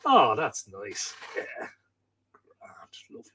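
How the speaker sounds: near-whisper, lost in the music; hushed blissful murmuring to a late-night tune; dreamy hushed murmur. Retold or imitated. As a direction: breathe it out softly like you're entranced. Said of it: "you're just going".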